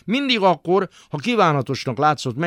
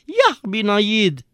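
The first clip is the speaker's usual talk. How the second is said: high, drawn-out whine; whimpering; 9 semitones higher, 3 dB louder, much slower than usual. Acted. Father whining the chant in the bathhouse, high whimper.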